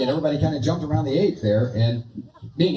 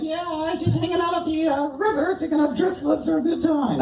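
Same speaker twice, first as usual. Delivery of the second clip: squeaky high puppet voice, shouted out; a cheeky dummy yelling hello from the box; ventriloquist dummy Bob; 11 semitones higher than usual. Acted. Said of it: "we're gonna do some ventriloquism here".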